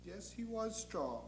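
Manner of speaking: very soft, humouring agreement; meek, placating angel; Gabriel. Acted. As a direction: agree quietly to appease him. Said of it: "And Gabriel says".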